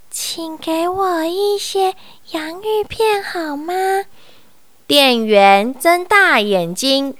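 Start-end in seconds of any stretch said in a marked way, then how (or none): 0.1-3.7 softer, higher timid request; small quiet voice. A narrator's reading. Mr Quiet timidly answers the clerk, soft and small. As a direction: ask shyly, barely audible. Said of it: "他小声地回答".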